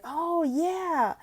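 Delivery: high, eager oh yeah; excited agreement. Shown as a demonstration. Tutor eagerly agrees with a student. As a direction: cheer the child on. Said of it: "and be like".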